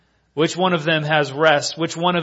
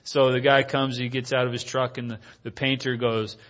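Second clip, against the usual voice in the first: usual speaking voice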